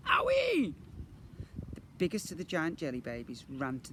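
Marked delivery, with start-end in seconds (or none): 0.1-0.7 loud, very high squeal of pain; high pain yelp. A narrator's reading. Twin whacked with a toy, high yelp.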